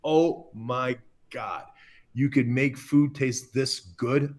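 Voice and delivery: slow, drawn-out awe; awestruck. Retold or imitated. Chef recalls his first bite of a truffle dish, awestruck. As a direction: stretch each word out in amazement. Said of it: "My mind just went".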